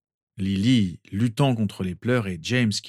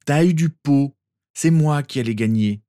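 louder, higher grumbling boast; sore loser; 6 semitones higher, 6 dB louder than usual. A narrator's reading. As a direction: play the sore loser, loud. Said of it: "fit le mauvais perdant". (mauvais perdant, plus fort)

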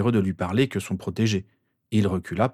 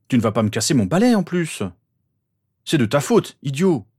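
raised, higher-pitched quarrel voice; angry scolding; 4 semitones higher, 4 dB louder than usual. A narrator's reading. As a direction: snap at a rival who nearly broke your broom. Boys quarrel over nearly broken broom.

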